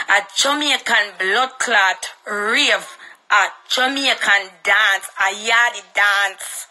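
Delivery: each word drawn out and weighted, very slow; spelling out Jamaican dance word by word for the rivals; slow emphatic list. Real, not acted. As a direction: lay out every word slowly to hammer the point. Ranting, spells out what the rave is.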